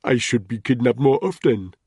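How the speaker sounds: put-on loud, smug Nigerian-accented joke. Ntoga joking to the guards, Nigerian accent. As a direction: gloat lightly in a Nigerian accent. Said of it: "in a marked Nigerian accent".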